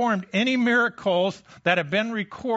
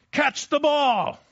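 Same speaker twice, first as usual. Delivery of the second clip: much higher and louder, a sharp exasperated shout; frustrated yell; 10 semitones higher, 5 dB louder, slower than usual. Retold or imitated. Parent yelling at a child in a little league game, frustrated shout. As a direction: yell it like a fed-up parent at the ball field. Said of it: "especially when it's done in, in frustration".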